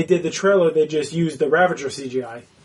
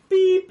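high-pitched little "beep"; imitated button beep; 14 semitones higher than usual. Retelling Groot pressing the button, imitated high beep. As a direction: mimic a button being pressed. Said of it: "He went like this".

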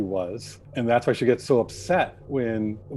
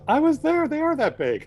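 a playful high Vastra impression; quoting Vastra. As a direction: toss it off high as the lizard lady. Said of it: "And Vastra says".

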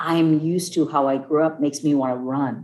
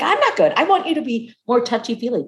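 much higher, pleading whine to her husband; whiny protest; 7 semitones higher than usual. Retold or imitated. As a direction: whine like a needy wife. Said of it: "I'm like".

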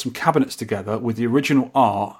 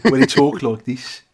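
Midlands accent. Shown as a demonstration. Showing his co-host how Halesowen folk talk, Midlands accent. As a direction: drawl it out in a broad Midlands twang. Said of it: "someone who talks like that".

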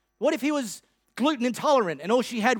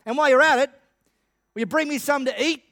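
mock call back, raised and higher; 5 semitones higher, 4 dB louder than usual. Elijah calls back to the widow, a raised mock call. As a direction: shout the afterthought over the shoulder. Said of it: "he called out".

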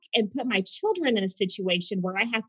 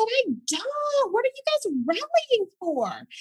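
high, sing-song curious question; wide-eyed naive asking; sweet clueless curiosity; innocent friendly self; 13 semitones higher, slower than usual. Retold or imitated.

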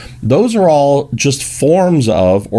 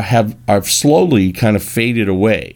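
ordinary talk

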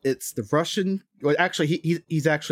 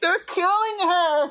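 high, slow, wailing mock panic; mock panicked cry; much slower than usual. Podcast host hams up horror at a movie death, mock wail. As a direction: wail it like a horrified onlooker, hammed up.

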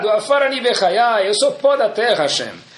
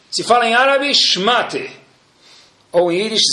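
ordinary talk, nothing marked

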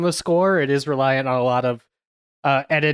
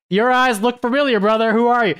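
higher, louder, curious squint; wondering who the masked guy is; surprised recognition; 8 semitones higher, 4 dB louder than usual. Retold or imitated. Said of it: "I'm like".

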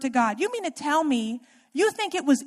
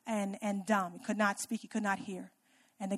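hushed; 4 semitones lower, 10 dB quieter than usual. Real, not acted. Softens describing deaf mute.

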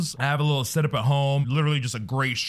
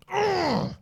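disgusted groan. Acted.

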